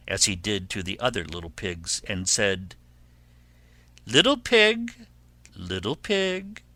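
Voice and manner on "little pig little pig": high, sing-out storybook call; lightly voiced wolf calling high; coaxing wolf. Wolf calls at the pig's door, high coaxing.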